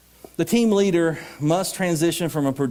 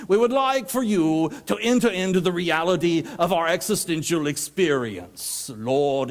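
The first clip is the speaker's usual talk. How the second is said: pompous liturgical prayer; 4 semitones higher than usual. Acted. Speaker parodying a prayer written by a great poet scholar, pompously intoned.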